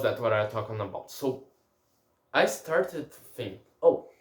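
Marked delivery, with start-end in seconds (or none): none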